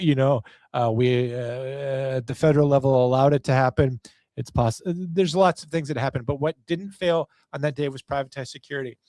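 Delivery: usual speaking voice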